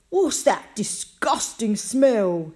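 loud, slow, revolted demand; disgusted. A narrator's reading. Mister Bragg asking about the smell, loudly disgusted.

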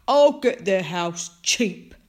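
slow, loud scheming villain; Mister Bragg. A narrator's reading. Mister Bragg scheming to himself, villainous. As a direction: plot aloud in a put-on villain voice. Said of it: "Mister Bragg said to himself".

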